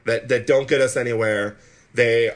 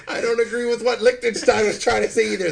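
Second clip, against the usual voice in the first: laughing; 10 semitones higher than usual. Real, not acted. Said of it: "that's a Spoonerism or something".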